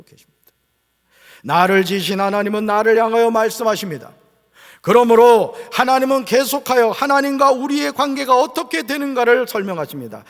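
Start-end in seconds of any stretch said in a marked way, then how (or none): 1.4-10.2 loud, sustained pulpit declaration; full-force preaching. Real, not acted. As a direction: proclaim it to the whole church.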